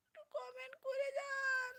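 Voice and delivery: mock crying. Streamer begs viewers for comments, mock crying. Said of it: "কেঁদেই দিলাম".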